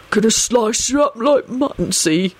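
put-on thug voice, mildly menacing; ominous. A narrator's reading. Baldy threatens Sirius with revenge, ominous. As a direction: threaten in a rough character voice. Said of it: "rumbled ominously at Sirius".

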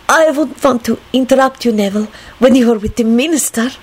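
put-on high, careful foreign-accented voice; flustered woman. A narrator's reading. Lady excuses herself to the young man, flustered. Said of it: "she stammered".

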